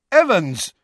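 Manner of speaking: high, loud call after someone leaving; shouted after her. A narrator's reading. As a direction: call her back across the hall. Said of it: "James shouted after her".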